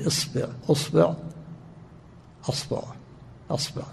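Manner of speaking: إصبع، أصبع، أصبع، أصبع with kasra on the ba, isbiʕ, usbiʕ, asbiʕ; vowel variants. Shown as a demonstration. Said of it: "ثم تكسر الباء وتثلثها".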